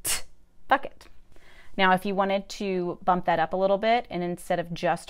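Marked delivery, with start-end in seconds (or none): 0.0-0.2 a quick isolated "t"; a light "t" tap, no vowel; t sound. Shown as a demonstration. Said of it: "put it in the".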